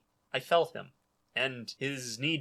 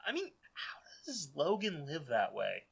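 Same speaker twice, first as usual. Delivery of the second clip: hushed whisper; 11 dB quieter than usual. Real, not acted. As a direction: whisper it in disbelief. Wondering about Logan's stress.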